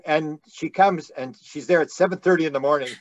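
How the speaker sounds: ordinary talk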